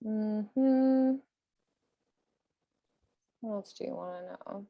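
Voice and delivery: normal talking voice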